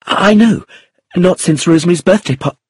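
high, hurried, cut short mid-word; distressed, breaking off. A narrator's reading. Young woman reminded of her sister's death, distressed. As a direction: blurt it high and stop dead. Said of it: "She stopped in distress".

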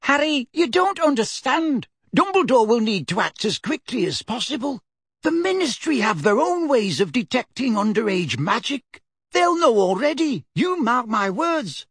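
put-on flustered elderly neighbour; fretful old lady. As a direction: panic about telling the headmaster. Mrs Figg warning Harry.

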